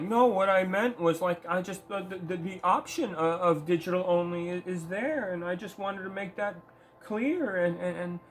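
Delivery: high, pleading, wimpy voice throughout; mock whiny apology. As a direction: mimic a spineless exec begging forgiveness. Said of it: "He was just like".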